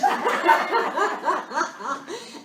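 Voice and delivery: loud, high, rolling laughter; hearty laugh. Real, not acted. Speaker amused by her beach story, hearty laugh. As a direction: laugh hard after the punchline.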